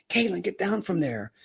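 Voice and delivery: mock yell at a daughter; retold yell. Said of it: "and I yelled again".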